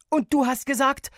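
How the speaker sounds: put-on high, loud outraged child; indignant. A narrator's reading. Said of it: "sagte ich entrüstet".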